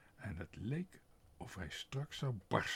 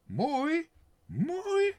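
very high, loud sarcastic echo of mooi; mooi, mooi spat out high and loud in scorn; mocking mistress; 11 dB louder than usual. A narrator's reading. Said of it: "Mooi niet waar?".

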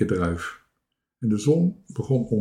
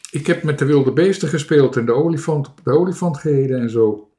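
fast, louder, higher boast; suddenly bold; 4 semitones higher, 6 dB louder, faster than usual. A narrator's reading. Toontje tells of the wild beasts, suddenly bold. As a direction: rattle it off boldly. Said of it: "zei Bange Toontje ineens flink".

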